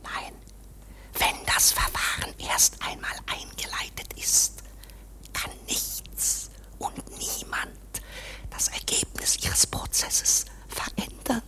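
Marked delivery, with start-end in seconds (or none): 1.2-11.4 voiceless hushed whisper; sly whisper. Acted. Clerk whispers that K should kill the student. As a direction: breathe the suggestion, hidden.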